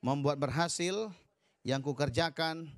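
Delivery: preacher dictating a prayer for the church to repeat; declaration prayer. Shown as a demonstration. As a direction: lead the room in a faith declaration, phrase by phrase.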